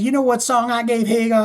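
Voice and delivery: [name]. Acted. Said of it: "And David says".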